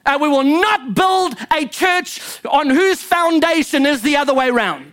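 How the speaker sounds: high, emphatic pulpit delivery; impassioned preaching. Real, not acted. Preacher driving a point home to the congregation, impassioned. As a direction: preach it hard, pitch riding up.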